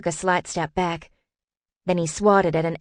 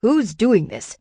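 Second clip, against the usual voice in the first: raised, higher-pitched demand; shouted; 4 semitones higher, 4 dB louder, slower than usual. A narrator's reading. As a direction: call it out in alarm at the assembly. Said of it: "another assembly member shouted".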